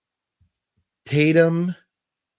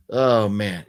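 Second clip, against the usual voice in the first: ordinary talk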